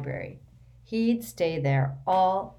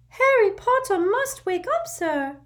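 much higher, louder squeaky elf voice; urgent little servant squeaking; an anxious house elf waking his master; 8 dB louder than usual. A narrator's reading. House-elf Dobby voiced throughout.

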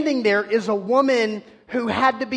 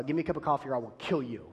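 grumpy morning threat; 5 semitones lower, 8 dB quieter, much faster than usual. Retold or imitated. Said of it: "that's more my attitude".

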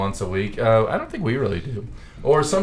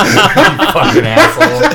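loud, high laughing insult; laughing shout; 10 semitones higher, 10 dB louder than usual. Real, not acted. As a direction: yell it through laughter.

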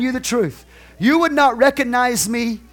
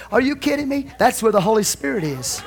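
ordinary talk

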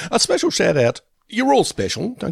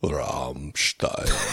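low, quiet German growl of [name]; deep German [name]; 12 semitones lower, 7 dB quieter than usual. Retold or imitated. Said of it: "as long as you say it like this".

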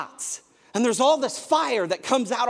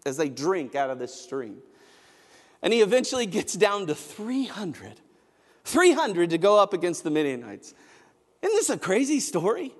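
normal talking voice

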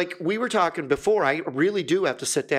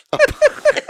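laughing; 8 dB louder than usual. Real, not acted. Cracks up mid-word, voice breaks.

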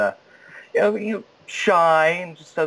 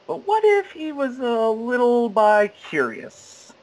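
high, drawn-out teasing delivery; teasing suggestion; 10 semitones higher, slower than usual. Acted. Actor pitching a cheeky twist on his character, teasing. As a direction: float it slowly in a raised, suggestive voice.

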